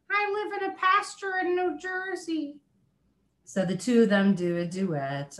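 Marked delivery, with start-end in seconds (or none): 0.1-2.6 much higher, shy cow puppet voice; a sweet, timid cow introducing herself; Moonice the cow voice. Pretend. Shy puppet cow telling the host where she lives, in a much higher voice. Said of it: "she talks like this".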